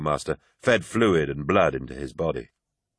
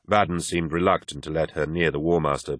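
usual speaking voice

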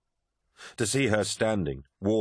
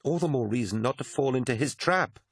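exclaiming; 7 semitones higher than usual. Acted. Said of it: "exclaimed Mercedy".

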